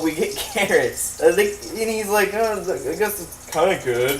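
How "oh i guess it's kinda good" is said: lightly voiced bunny, casual; a hint of a snooty rabbit. Bunny grudgingly tasting the carrot, lightly voiced. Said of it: "that's the bunny's voice".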